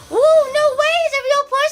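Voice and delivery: high, loud shocked gasp of disbelief; a real person, no way, squealed way up high and loud; telling a friend about a never-seen housemate, squealing in shock; amazed. Retold or imitated. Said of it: "I was like".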